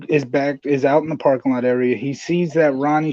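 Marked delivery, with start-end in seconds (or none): none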